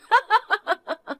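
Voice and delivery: high, mocking giggle; smug cackle at the aunt; gloating laugh. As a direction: laugh in her face. Niece gloats over stealing husband.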